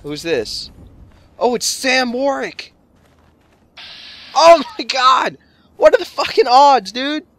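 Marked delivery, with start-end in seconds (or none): 1.4-7.3 real gamer shock, yelling out; excited shout. Real, not acted. Meets famous NPC in game.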